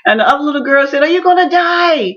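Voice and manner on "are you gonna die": put-on high, tearful child's question; frightened child's cry; scared child. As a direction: cry it out like a frightened little girl. Child asks if she'll die.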